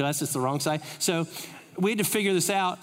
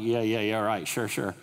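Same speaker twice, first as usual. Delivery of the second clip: sarcastic dismissal; 7 semitones lower than usual. Retold or imitated. Skeptical reply to angel claim.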